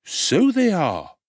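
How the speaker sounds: high, slow, fawning undertaker voice; high ingratiating tradesman; oily undertaker. Acted.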